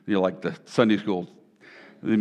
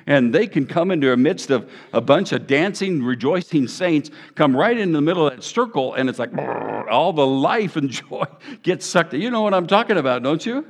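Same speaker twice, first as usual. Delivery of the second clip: rising, louder, building to a climax; building preaching; 5 semitones higher, 5 dB louder than usual. Real, not acted. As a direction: preach it harder and harder to the crowd.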